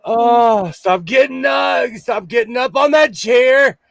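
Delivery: ordinary talk, nothing marked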